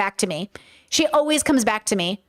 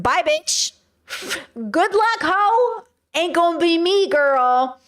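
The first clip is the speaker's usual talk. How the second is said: high, sassy brush-off; sassy dismissal; 5 semitones higher, much slower than usual. Pretend. Streamer sassily refusing to chase a stranger's cat, high and flippant. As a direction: wave off the stranger's cat with attitude.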